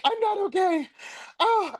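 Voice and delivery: high-pitched mock wail; little meltdown. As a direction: whine it out in a comic meltdown. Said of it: "having a little meltdown on the phone".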